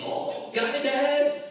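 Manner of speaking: put-on high, eager kid voice; excited kid. Comedian playing Steve as an excited schoolboy, high voice. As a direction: bounce in like a thrilled little boy.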